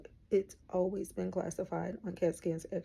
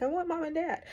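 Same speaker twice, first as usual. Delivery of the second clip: put-on whiny child voice; 9 semitones higher, 4 dB louder than usual. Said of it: "you're just like".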